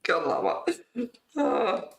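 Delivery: usual speaking voice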